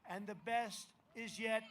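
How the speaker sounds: soaring patriotic rally climax; rally speech. Acted.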